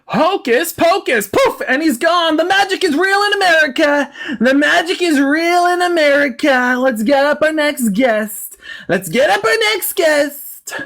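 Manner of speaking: high, drawn-out conjuring chant; hammed-up triumphant Southern emcee; hillbilly showman. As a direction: proclaim it like a country carnival magician. Mock magician-showman send-off.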